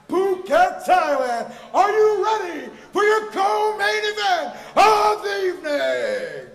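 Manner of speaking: booming drawn-out ring announcer call. Acted. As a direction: roar it to the arena. Ring announcer hypes crowd.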